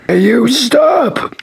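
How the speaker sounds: high, slow shouted command; guard shouting. Acted. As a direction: yell it like a guard. Guard yells at intruder.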